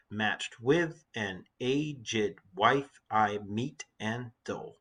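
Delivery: very slow, each syllable separated; deliberate, spaced-out verse reading; counting out syllables. Shown as a demonstration. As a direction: tick off the line syllable by syllable. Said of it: "let's count the syllables in the lines".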